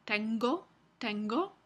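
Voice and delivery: tengo with a stopped g after the n; tengo, a firm g after ng. Shown as a demonstration. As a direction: close fully on the g in tengo. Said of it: "Después de un nasal".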